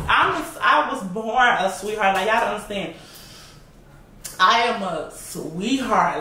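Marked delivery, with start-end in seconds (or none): 4.4-6.2 slow, drawn-out declaration; slow insistence. Real, not acted. Woman playfully telling viewers her nature, slowly insistent. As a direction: stretch out every word to insist.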